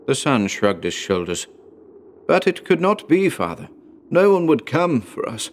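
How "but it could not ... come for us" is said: higher-pitched, earnest protest; doubting son. A narrator's reading. Son tells father nobody will come, in higher-pitched protest. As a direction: gently argue against a false hope.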